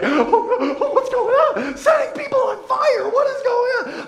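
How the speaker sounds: mock panic. Pastor imitates visitors fleeing the fiery song, high mock panic. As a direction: squeal like startled churchgoers fleeing. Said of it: "They were like".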